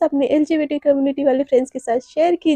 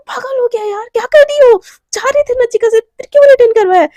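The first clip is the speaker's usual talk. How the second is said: panicked scolding; 8 semitones higher, 6 dB louder than usual. Acted. Naina scolds Shivani in panic.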